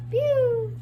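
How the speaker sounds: high playful "Pew!" like a bad smell. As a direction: say "Pew!" high and playful, holding your nose. Book sound effect.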